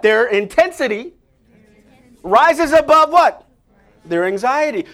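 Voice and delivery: ABOVE lifted high and loud, then a falling drop; preacher builds the line so the crowd can echo it; call and response. Shown as a demonstration. Preacher leading the crowd in a repeated line, loud. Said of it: "Say, say to someone next to you".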